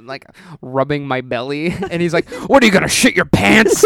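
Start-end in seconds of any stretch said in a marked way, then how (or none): 2.5-3.9 louder, higher incredulous question; hammed-up cop jeering; mock cop taunt. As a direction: imitate a loud, incredulous cop. Cop mocks the nervous teenager.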